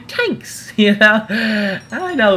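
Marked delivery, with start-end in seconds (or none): 0.1-0.7 "Thanks" said as "tanks"; Irish hard T. Shown as a demonstration. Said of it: "we don't say thank you".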